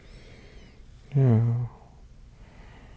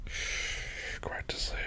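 whispering over a crib; 17 dB quieter, much slower than usual. Pretend. Soothing partner woken on couch.

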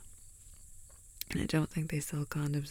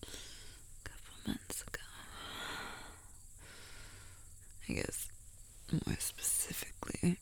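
whispering; 5 dB quieter than usual. Acted. Secret whispered roleplay.

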